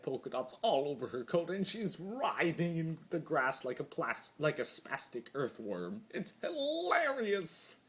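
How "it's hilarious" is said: high, slow cry of glee; gleeful delight. A narrator's reading. Chaos spirit gloating over the screaming pony, slow gleeful cry. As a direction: savour every syllable of the joke.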